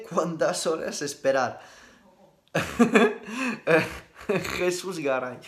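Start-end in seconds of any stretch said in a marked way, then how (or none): 2.5-4.0 a high, loud burst of real laughter; a sudden high laugh. Real, not acted. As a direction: break into real laughter at the question. Laughs at a listener's question.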